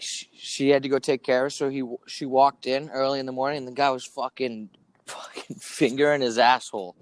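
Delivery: normal talking voice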